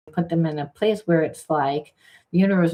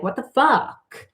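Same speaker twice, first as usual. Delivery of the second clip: exasperated; 8 semitones higher, slower than usual. Retold or imitated. Both triggered, voiced exasperation.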